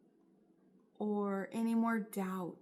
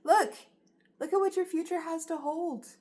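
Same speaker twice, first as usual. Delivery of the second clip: much louder, higher eager "look, look"; excited urging; 5 semitones higher, 6 dB louder than usual. Acted. Odonis urging the viewer to see the future, thrilled and loud. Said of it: "Odonis is coming forward saying".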